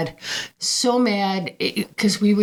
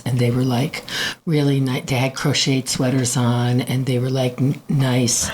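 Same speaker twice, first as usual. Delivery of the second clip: low monotone voice; 6 semitones lower than usual. Shown as a demonstration. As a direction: talk low and flat like the skit. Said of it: "they talk like this".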